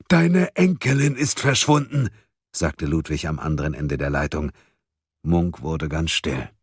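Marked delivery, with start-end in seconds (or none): 0.1-2.1 high, emphatic announcement; urgent bad news. A narrator's reading. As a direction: break through to him with grave urgency. Ludwig urgently breaks bad news. (eindringlich, mit hoher Stimme)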